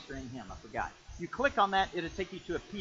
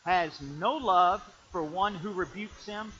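scripture reading; 2 semitones higher, 5 dB louder, slower than usual. Shown as a demonstration. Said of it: "it says".

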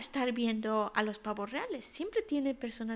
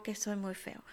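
sad little whisper; whispered self-pity; 3 semitones lower, 7 dB quieter than usual. Acted. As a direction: breathe it out ashamed. Crow's self-pity ends quote.

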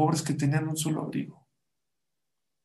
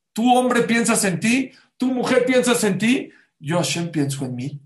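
loud, high, driving sermon delivery; forceful preaching; 5 semitones higher, 7 dB louder than usual. Acted. Preacher warns couples who think only of themselves, loud and forceful. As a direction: hammer each clause home at full force. Preacher drives home the moral.

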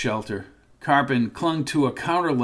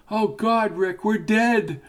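frightened; 7 semitones higher, slower than usual. Acted. Rita panics in haunted store.

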